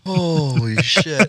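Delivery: slow drawn-out. Real, not acted.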